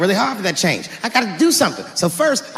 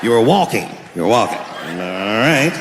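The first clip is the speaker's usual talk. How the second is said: much lower, tired cop voice; weary police veteran; 10 semitones lower, 3 dB quieter than usual. Acted. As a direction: sigh through the report like a jaded officer. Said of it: "a police veteran taking this kid's police report".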